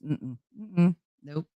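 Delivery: ordinary delivery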